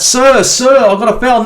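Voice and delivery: high, pleading mock cry of a player diving for a foul; mock appeal to the ref. Fan mocking players who dive and beg the ref, high pleading voice. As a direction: whine it like a player begging the referee. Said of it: "You can yell out".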